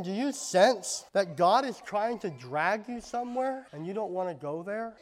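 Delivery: usual speaking voice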